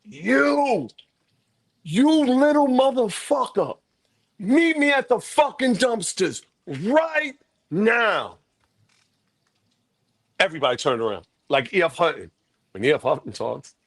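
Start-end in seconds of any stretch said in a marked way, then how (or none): 0.0-8.4 big booming voice, pitched up and drawn out; slow, commanding holler, stretching each word; Wes Johnson. Retold or imitated. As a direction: summon someone in a big, imposing voice. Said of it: "he had this big voice".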